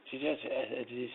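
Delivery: normal talking voice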